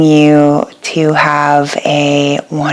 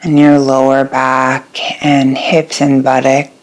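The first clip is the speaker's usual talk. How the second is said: guided meditation. Acted. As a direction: guide them gently into rest, letting each phrase settle. Leads a yoga nidra meditation.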